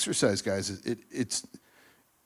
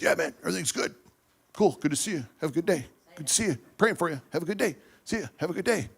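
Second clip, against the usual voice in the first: higher-pitched, quick-fire mock pleasantries; breezy greetings; 6 semitones higher, much faster than usual. Churchgoer hiding problems behind greetings, mock breezy. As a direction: toss off cheery hellos in passing.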